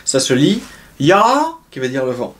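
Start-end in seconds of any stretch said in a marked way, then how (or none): 0.0-1.2 "ya" with a rising tone; "ya" avec l'accent qui monte; rising tone demo. Shown as a demonstration. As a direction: let "ya" climb like the tone mark. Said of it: "l'accent qui monte".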